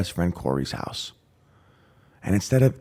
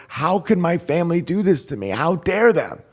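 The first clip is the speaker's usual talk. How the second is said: high-pitched mock-distraught lament; hysterical crying, retold; 9 semitones higher, 4 dB louder than usual. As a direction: wail the outrage without real tears. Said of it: "I'm crying hysterical".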